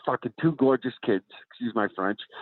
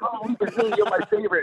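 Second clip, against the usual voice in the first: gushing fan; 7 semitones higher than usual. Retold or imitated. Acting out a starstruck fan.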